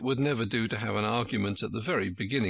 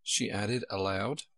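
usual speaking voice

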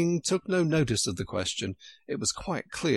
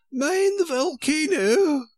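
much higher, louder, slow warning call; queen calling out a warning to the king; 6 dB louder, slower than usual. Acted. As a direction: shout the warning across the room.

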